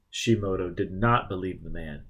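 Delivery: ordinary delivery, nothing marked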